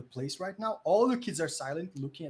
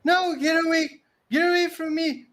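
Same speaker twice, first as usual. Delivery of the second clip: high, loud frightened cry; panicked child pleading loudly; terrified kid; 14 semitones higher, 10 dB louder than usual. Acted. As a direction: scream at something unseen to go away. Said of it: "the kid starts saying things such as like".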